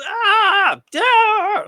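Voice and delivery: high, loud pained scream of "Ah, yeah"; mimicked death scream. Shown as a demonstration. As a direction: wail it like a dying man. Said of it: "I'm gonna mimic it now".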